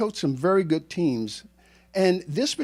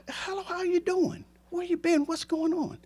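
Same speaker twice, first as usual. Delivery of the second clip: much higher, bright, friendly greeting; cheery hello; 11 semitones higher, 4 dB quieter than usual. Retold or imitated. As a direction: greet a long-lost student warmly. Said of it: "I Facebook him a message".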